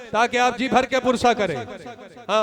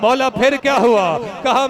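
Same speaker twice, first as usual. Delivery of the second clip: ordinary talk, nothing marked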